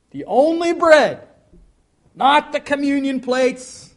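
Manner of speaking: high, loud and insistent; emphatic preaching shout. Real, not acted. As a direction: cry it out from the pulpit. Preacher drives the point home.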